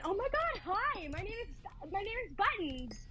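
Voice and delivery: put-on high bubbly girly greeting. Shown as a demonstration. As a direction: overdo the cute introduction. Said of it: "easier to talk like this than".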